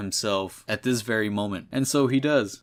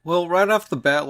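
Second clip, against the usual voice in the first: excited opener; 7 semitones higher, 6 dB louder than usual. Real, not acted. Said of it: "excited reaction to comic".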